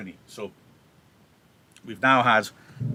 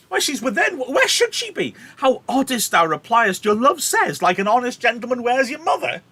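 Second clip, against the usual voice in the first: high, loud, exasperated Juliet; incredulous outburst; 12 semitones higher, 7 dB louder than usual. Acted. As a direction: throw the nurse's words back at her, shrieking. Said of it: "Juliet can't believe that".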